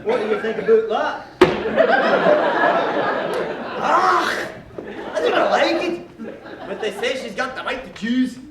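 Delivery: hammed-up Scottish accent, laughing through it. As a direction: mangle Shakespeare in a comic Scottish accent. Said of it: "in perfect Scottish accents".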